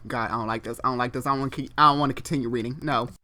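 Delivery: usual speaking voice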